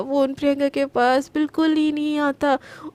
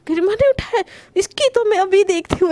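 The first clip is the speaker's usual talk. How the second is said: angry; 5 semitones higher, 4 dB louder than usual. A narrator's reading. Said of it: "एकदम गुस्से में बोलती है".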